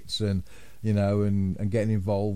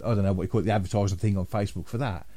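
normal talking voice

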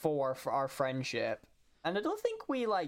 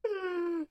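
pterodactyl shriek. Real, not acted. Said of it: "listen to me make freaking bird noises".

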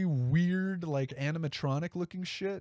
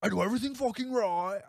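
retry a clumsy star impression, rising into a rant; bad Nicolas Cage; 3 semitones higher than usual. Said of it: "Nope, this is embarrassing".

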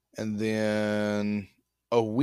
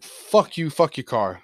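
retold angry outburst; 4 semitones higher, 6 dB louder, slower than usual. Retold confrontation with friend over money.

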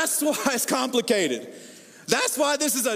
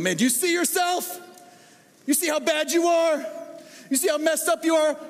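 jab each question at them, much higher; accusing rant; 8 semitones higher than usual. Pretend. Acted accusing a spouse, coworker.

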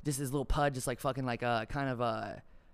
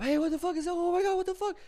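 much higher startled cry; shocked crowd; 4 dB louder than usual. Retold or imitated. Fans spotting a body in the sky, shocked high cry. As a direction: yelp up at the sky in disbelief.

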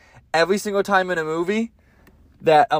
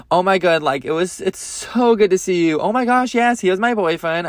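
mock-excited greeting, pitched up; gushing fake friend; 4 semitones higher than usual. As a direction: parody two acquaintances gushing over each other. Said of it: "this is exactly how they were, they were talking".

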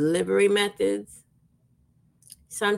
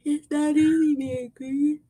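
higher, set-apart quote voice; quoting a saying; 7 semitones higher than usual. Retold or imitated. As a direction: lift it off as a famous line. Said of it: "As they say".

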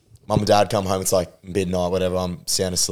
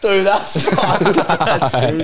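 laughing; 10 semitones higher, 5 dB louder, much slower than usual. Real, not acted. Laughing while quoting Dad.

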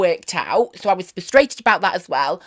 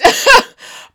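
high laugh; 11 dB louder than usual. Real, not acted. Laughs at crashed TikTok.

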